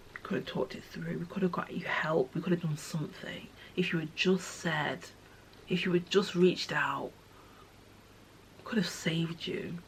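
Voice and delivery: very soft, half-whispered regret; breathy, quiet lament; hushed regret. Retold or imitated. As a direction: murmur grieving if-onlys to the one lost. Addressing dead friend in grief.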